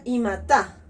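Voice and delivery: "ima ta" with the accent landing on ta; stress on ta. Shown as a demonstration. Teacher shows the students the accented ta, stressing the final syllable. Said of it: "con la partícula ta con acento y se pronuncia".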